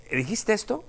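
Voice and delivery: pregunta incrédula, aguda y subiendo al final; incredulidad burlona. Retold or imitated. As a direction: imita al que finge no haber entendido. Gerente que finge no entender. (pregunta con incredulidad fingida)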